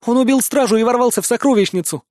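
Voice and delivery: fast, high tearful outburst; crying out. Acted. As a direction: rush it out through tears. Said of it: "брызжут слёзы".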